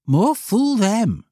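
rude schoolboy, high and loud; slow, scornful boy's retort. Acted. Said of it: "at once rudely and ungrammatically".

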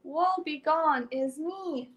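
ordinary delivery, nothing marked

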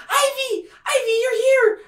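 tiny creature calling to its rescuer; Hash Brown. Acted. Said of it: "As soon as he says Ivy".

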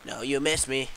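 normal talking voice

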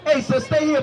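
ordinary talk

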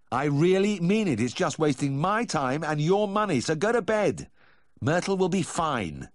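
high, emphatic exasperation; fed-up, insistent refusal; exasperated insistence. Acted. Vet firmly refuses on phone.

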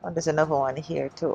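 normal talking voice

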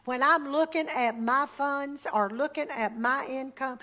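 ordinary talk

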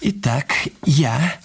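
slow, halting words as he writes; dictating slowly. Acted. Husband writes confession, dictating slowly to himself. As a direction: spell it out while writing, word by word. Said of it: "husband writes dictated confession aloud".

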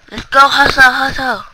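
loud, high shout to get moving; hype shout. Acted. Player urging himself to hustle, a loud high hype shout. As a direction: pump yourself up like a coach yelling.

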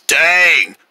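normal talking voice

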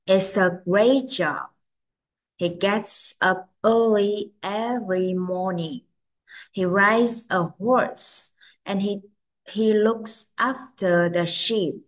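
slow, careful model reading. Shown as a demonstration. Teacher reads the next picture for children, very slowly. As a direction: read it slowly for learners to follow. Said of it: "Cô sẽ đọc rất là chậm".

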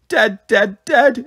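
put-on high, singsong toddler echo; chiming echo. A narrator's reading. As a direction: chime it like a little bell. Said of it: "echoed Pixie like a little chiming bell".

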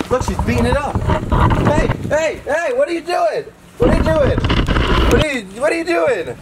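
hey hey, stop her with a high yell; alarmed yelling. Real, not acted. Vlogger yelling at a tortoise attacking his GoPro, high and loud.

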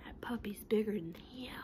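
hushed whisper, eyes on the big puppy; nervous whisper. Acted. Pigeon whispering to the class about the puppy, nervous whisper. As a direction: breathe it out as a scared secret.

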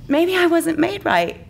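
high, anxious little voice; worried confession. Acted. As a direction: confess the fear timidly. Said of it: "worrisome confession".

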